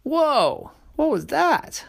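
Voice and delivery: high, loud startled little voice; startled tortoise. Acted. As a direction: squeak it out in surprise.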